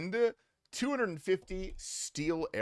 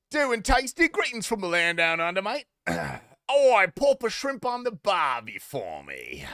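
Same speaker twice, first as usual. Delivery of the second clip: hammed-up Australian accent, a bit louder; 5 semitones higher, 5 dB louder than usual. Streamer greeting chat as a jokey Australian, hammed-up Australian accent. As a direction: play the cheery Aussie bloke in a broad Australian accent. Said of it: "how the Australian people make it roll".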